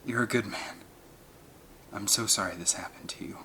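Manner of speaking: soft, choked near-whisper; choked with emotion. A narrator's reading. Alan to the injured Billy, choked and whispering. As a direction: hold back tears as you speak. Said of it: "His voice was choked with emotion".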